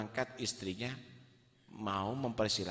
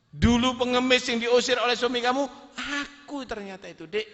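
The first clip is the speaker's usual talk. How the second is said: triumphant reveal; 12 semitones higher, 9 dB louder, faster than usual. Acted. Husband reveals he was the beggar.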